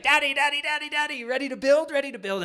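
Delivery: put-on very high, loud excited child's chant; a kid bursting to build Legos. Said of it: "it's like, he's like".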